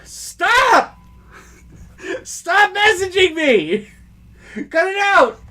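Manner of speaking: loud high yell through laughter; half-laughing shout to make it stop; exasperated yell. Retold or imitated. Yelling in mock exasperation, laughing.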